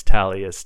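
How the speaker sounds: Tallius as TAL-ee-us, first syllable stressed loudly; pronunciation variant. Shown as a demonstration. Guest shows how people pronounce the site, Tallius as TAL-ee-us. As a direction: say Tallius with a punched TAL, then ee-us. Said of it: "people pronounce it all over the place".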